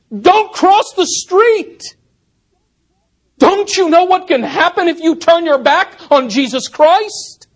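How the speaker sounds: a pastor shouting a desperate warning to his flock; urgent warning cry. Acted. Pastor crying out to Christians under fire, loud urgent warning. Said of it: "he cries out".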